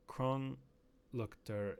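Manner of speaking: clumsy attempt at reading a German word; unsure, mangled German; bad German pronunciation. Real, not acted. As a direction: sound out German haltingly and badly. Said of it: "I don't know how to pronounce anything in German".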